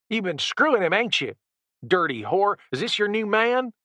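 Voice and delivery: high-pitched jealous ranting; furious accusation. A narrator's reading. Randy accusing Jessica, high and venomous. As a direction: spit the insult up high.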